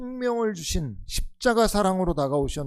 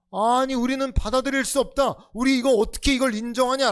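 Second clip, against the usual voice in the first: 1 indignant protest; 6 semitones higher, 3 dB louder than usual. Retold or imitated. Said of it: "라고 그럴 만했다는 거예요".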